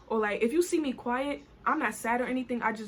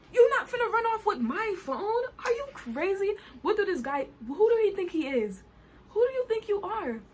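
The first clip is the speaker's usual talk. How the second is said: high-pitched, incredulous outrage; scandalized, rising in disbelief; indignant retort; 8 semitones higher than usual. Acted. Rider refuses to hand over her phone, indignant.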